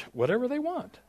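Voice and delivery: very high, squeaked-up yes; startled agreement. Retold or imitated. Guest preacher asked to run whole service, high-pitched agreement.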